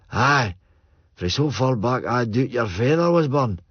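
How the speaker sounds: put-on old Joe boasting of his roots in broad Scots. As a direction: lean into the old Scots brogue.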